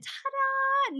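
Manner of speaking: high, drawn-out ta-da; showy ta-da. Pretend. Host unveiling her checklist page to viewers, drawn-out ta-da. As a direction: present the page like a magic trick. Reveals finished page with flourish.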